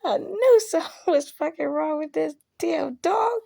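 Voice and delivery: high, half-crying outburst; exasperated outburst. Retold or imitated. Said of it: "I said".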